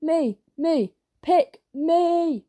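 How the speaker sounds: eager shouting. A narrator's reading. Kids shouting to get the man's attention, eager cries. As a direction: pipe up like kids begging to be picked. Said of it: "were shouting and waving".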